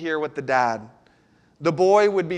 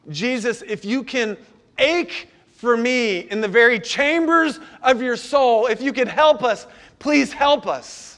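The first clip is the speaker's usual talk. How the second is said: higher and louder, pleading cry to Jesus; father crying out to Jesus for his son, anguished pleading; desperate plea; 6 semitones higher, 3 dB louder than usual. Acted. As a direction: beg for help from the gut, slowing on the last plea. Pastor voices desperate father's plea.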